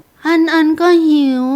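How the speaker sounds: put-on little boy. Small boy says he is hungry.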